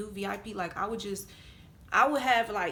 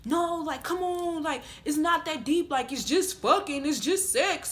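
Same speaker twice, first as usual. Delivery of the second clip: higher, insistent mock pressure; raised-pitch pestering protest; retold aggressive pushing; 6 semitones higher, 4 dB louder than usual. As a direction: imitate a man pushing impatiently. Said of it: "getting real aggressive".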